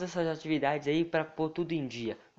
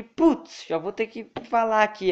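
exasperated; 7 semitones higher, 6 dB louder than usual. Real, not acted. Dreading having to give speech.

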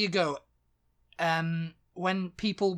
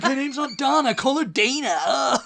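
high, laughing mock complaint, slowed on call her Dana; mock exasperated laughing; 8 semitones higher, 7 dB louder than usual. Friend retells insisting the guest is called Dana, laughing. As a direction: laugh through the correction, hammed up. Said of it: "And it's like".

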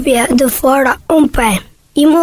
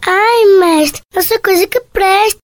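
pleading goat voice; 7 semitones higher than usual. Acted.

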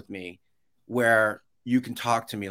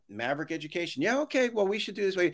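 higher, softer polite work voice; professional meeting voice; 6 semitones higher, 5 dB quieter, faster than usual. Acted. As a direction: put on your polite meeting manner, pitched up. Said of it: "I'll be in a professional meeting".

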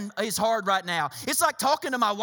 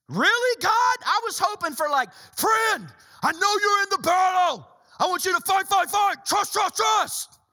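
high, loud mock-urgent pep talk; hammed-up battle cry, hammering the repeated words; hammed-up rallying cry; 7 semitones higher, 4 dB louder than usual. Preacher acting the friend he hoped to hear, hammed-up urgency.

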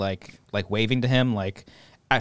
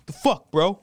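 higher mock tough-guy bro; a hint of a hammed-up bro, higher; dumb bro; 7 semitones higher than usual. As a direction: puff up the chest and play the dumb guy. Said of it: "any stupid man would just be like".

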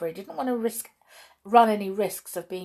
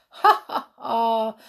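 loud, acted "ha ha ha"; scoffing storybook laugh; mocking laugh; 3 semitones higher, 5 dB louder, slower than usual. A narrator's reading. Onlooker jeering at the brownies, mocking laugh. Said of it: "laughed a fourth".

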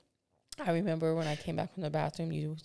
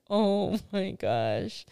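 drawn-out, loud shocked gasp of words; shocked oh my gosh; 2 semitones higher, 6 dB louder, much slower than usual. Retold or imitated. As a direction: stretch it out, stunned and embarrassed. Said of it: "And I'm like".

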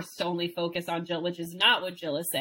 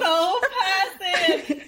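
high, very slow, drawn out through laughter; laughing echo; 12 semitones higher, 5 dB louder, much slower than usual. Real, not acted. Cohost echoing the host about Romeo, laughing drawn-out echo.

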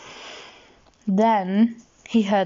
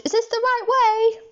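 very high-pitched excited question; high, eager squeak of a query; eager Tigger. A narrator's reading. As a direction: pipe it up like a bouncy creature. Bouncing Tigger asks eagerly.

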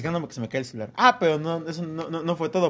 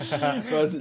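ordinary delivery

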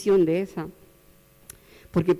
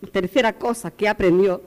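normal talking voice

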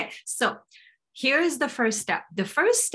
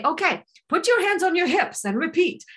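higher, lightly acted coach pep; higher-pitched mock instructions; 8 semitones higher than usual. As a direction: play a coach leading an exercise. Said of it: "for me to say".

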